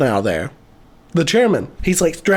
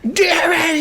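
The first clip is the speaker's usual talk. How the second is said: high-pitched mock outburst; hammed-up high squawk of frustration; pissed off; 12 semitones higher than usual. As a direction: imitate a thwarted doctor cursing. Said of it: "he's so pissed off".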